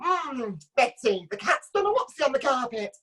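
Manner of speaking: a hint of a fussy, flustered man; gentle Frank Spencer whimper, slightly high; Frank Spencer impression. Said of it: "Frank Spencer".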